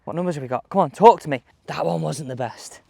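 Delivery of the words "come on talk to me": ordinary delivery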